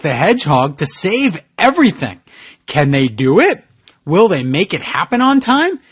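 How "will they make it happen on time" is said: usual speaking voice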